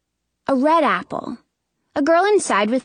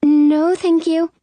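put-on higher, louder girl's voice; polite refusing girl; 7 semitones higher, 4 dB louder, slower than usual. A narrator's reading. Girl behind curtain refusing apples, polite. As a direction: decline politely, pitched up.

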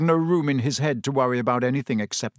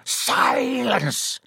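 sharp high snarl; 10 semitones higher than usual. A narrator's reading. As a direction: snap it shut. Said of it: "snarled Snape".

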